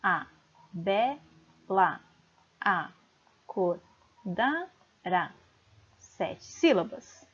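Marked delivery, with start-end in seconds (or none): 0.0-3.8 slow, separated syllables of "A bela acordara". Shown as a demonstration. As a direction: chop "A bela acordara" into single syllables, pausing after each. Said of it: "Vamos fazer a divisão".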